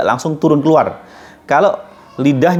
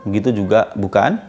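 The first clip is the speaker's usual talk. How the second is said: ordinary talk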